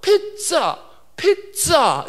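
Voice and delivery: "피자" said the English way as "pet-tsa"; teacher's sudden recognition, "피자" as a stretched "pet-tsaa"; "피자" with a sharp ts in the middle; British pronunciation. Retold or imitated. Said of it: "피아이 즈 즈 에이 쓰니까 아".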